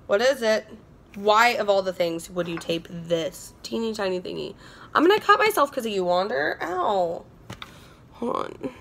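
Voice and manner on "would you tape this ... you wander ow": normal talking voice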